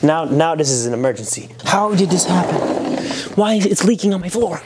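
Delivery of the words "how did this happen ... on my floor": panicked. Real, not acted. Leak panic.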